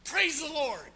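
high, loud cry of thanks; relieved shout to heaven. Acted. Preacher thanking heaven after the cliff, loud relieved cry. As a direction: fling the praise skyward, loud and high.

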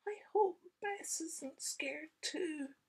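slow, high whisper. Acted. Sam whispers his worry about Bess. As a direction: whisper it fearfully. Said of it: "whispered Sam".

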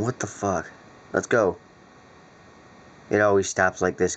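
usual speaking voice

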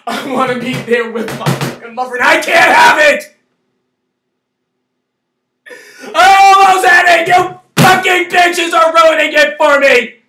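furious, high-pitched screaming rant. Real, not acted. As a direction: scream it out in real fury. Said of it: "I'm sorry for screaming".

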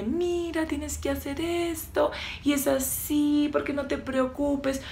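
high, soft, slow singsong; mock-soothing, drawn-out coddling voice; coddling advice. Coach imitating advice she won't give, sugary coddling. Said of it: "no te lo quiero decir en plan de".

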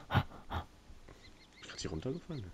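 normal talking voice

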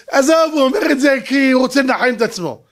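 put-on waving-away voice; mock dismissive. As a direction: brush him off as self-consoling. Mimics adults dismissing his claim.

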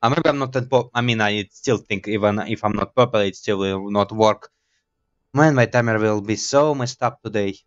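ordinary talk, nothing marked

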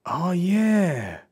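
high, drawn-out recognition; imitated dawning recall. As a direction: act out slowly remembering. Voices believer recalling pastor's teaching.

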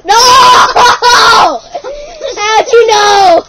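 laughing shout of mock dismay; mock shocked shout. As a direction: cry out in hammed-up shock.

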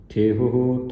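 ਥੇਹ ਹੋ with both ਹ sounds clearly breathed. Shown as a demonstration. Teacher makes students hear both ਹ sounds in ਥੇਹ ਹੋ. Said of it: "ਦੋ ਹਾਹੇ ਨੇ ਉਚਾਰਨ ਹੋਣਗੇ".

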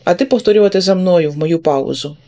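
usual speaking voice

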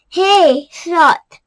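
loud high call across the jungle. A narrator's reading. Monkey calling up to the lazy sloth, loud call. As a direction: shout up to the hammock. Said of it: "called Monkey".

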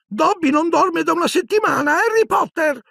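very high, proud squeaky exclamation; proud elf exclaiming. A narrator's reading. Dobby proudly boasting to Harry, very high squeak. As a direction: puff up with pride and squeak it. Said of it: "esclamò Dobby fiero".

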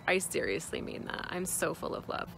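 ordinary talk, nothing marked

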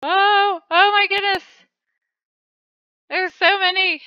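high, loud burst of delight; excited exclaim. Real, not acted. Player seeing loads of orbs, high excited exclaim. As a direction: gush over the pile of treasure. Sees many glowing orbs.

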